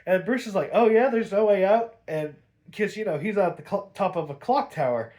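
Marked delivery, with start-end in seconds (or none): 0.7-2.0 louder, higher questioning comeback; challenging echo. Retold or imitated. Bruce answering Mad Hatter atop a clock tower, challenging echo. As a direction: throw it back as a defiant question, rising at the end.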